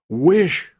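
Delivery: loud, high, insistent order; repeated command. A narrator's reading. Wife overriding her husband's protest, loud insistent command. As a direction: insist with full force. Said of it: "repeated his wife".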